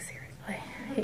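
barely voiced, slow "seriously?"; whispered disbelief. Patient to doctor who wants to keep going, whispered disbelief. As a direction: whisper it back, drawn out.

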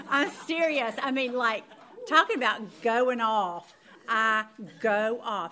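loud, heated and slowed; raising her voice about her rage; worked-up loud. Real, not acted. Telling her listeners how mad she gets, loud and heated.

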